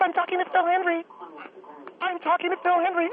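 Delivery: high-pitched excited aside to someone in the room; boastful aside. Pretend. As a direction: crow it in a high voice, showing off to your husband. Shows off to Herb in the room.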